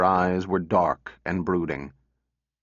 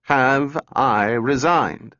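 slower, insistent repeat, RESIGNED pushed high; anxious repeat; 5 semitones higher, 4 dB louder, much slower than usual. A narrator's reading. Lanning repeats his question to the silent robot, mildly anxious. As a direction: press the question anxiously, drawing it out. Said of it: "Lanning repeated anxiously".

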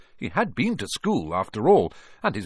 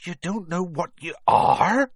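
raised voice, loud rising end; bewildered disbelief; 9 semitones higher, 5 dB louder than usual. A narrator's reading. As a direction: build to an astonished question. Giant bewildered at the boy.

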